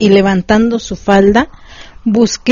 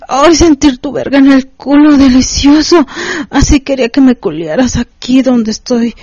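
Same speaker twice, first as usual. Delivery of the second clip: much higher cries of pain and pleasure; crying out; 5 semitones higher than usual. A narrator's reading. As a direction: cry out sharply, voice jumping up. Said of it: "Gritó de dolor". (gritos agudos de dolor y placer)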